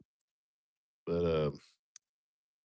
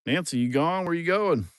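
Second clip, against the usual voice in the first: calling out; 8 semitones higher, 5 dB louder than usual. Real, not acted. Calling after a departing animal.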